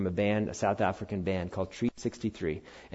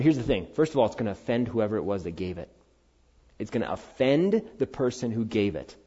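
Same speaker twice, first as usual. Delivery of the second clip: usual speaking voice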